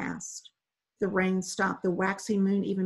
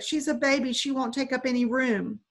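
higher, pleading appeal; begging; 5 semitones higher than usual. Acted. Boy begs strangers to save his baby sister, higher pleading. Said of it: "Mahmoud begged".

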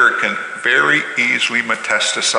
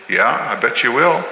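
ordinary talk, nothing marked

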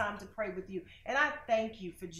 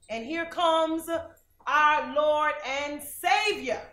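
high, loud, slow preaching climb; preaching build; 6 semitones higher, 10 dB louder, slower than usual. Acted. Preacher building to the climax of peace, loud. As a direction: build to the Savior's arrival.

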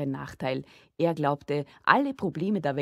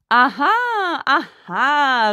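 long, high, loud mock realisation; calling out in chorus; 9 semitones higher, 11 dB louder, much slower than usual. A narrator's reading. Father and son chime in together, loud mocking cry. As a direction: stretch it into a loud knowing cry. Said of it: "riefen Vater und Sohn Sigi im Chor".

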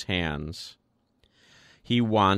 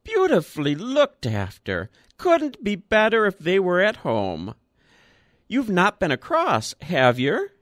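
put-on high piping old man; thin old voice, pleased; higher, louder old man's chatter; piped old voice; 9 semitones higher, 3 dB louder than usual. A narrator's reading. Old Woodfield continues.

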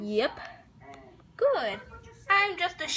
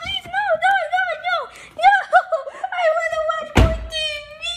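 very high, fast begging; high panicked begging; 13 semitones higher, 4 dB louder, faster than usual. Acted. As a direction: plead in a high rush. Mario begs not to go.

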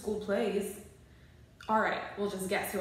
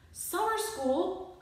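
slow, high disbelieving cry; outraged shout; 11 semitones higher, 3 dB louder, much slower than usual. Acted. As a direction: drag it out in horror. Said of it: "I shout to Abraham Lincoln".

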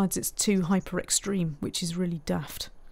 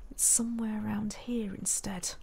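whispered under the breath; whispered aside while squinting at the screen; whispering to self; 10 dB quieter than usual. Real, not acted. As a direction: mutter it to yourself in a whisper. Muttering to self while building.

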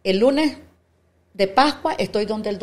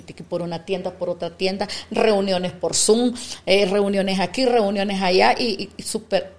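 normal talking voice

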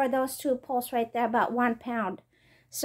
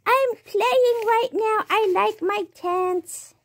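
high, slow cutesy pet voice; cat's voice; 9 semitones higher, slower than usual. Pretend. Owner voicing her cat playing in the new tent, high and slow. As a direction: talk like the playful cat in the tent. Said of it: "He's like".

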